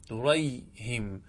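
دريهم stretched out, the ه clearly broken; slow repeat of دريهم. Shown as a demonstration. Teacher to class, repeating دريهم slowly. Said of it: "فنقول دريهم".

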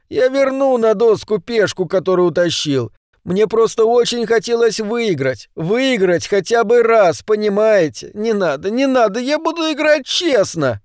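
high-pitched whining plea, climbing at the end; begging. A narrator's reading. Cornered orange man promises to play fair, begging. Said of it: "взмолился он".

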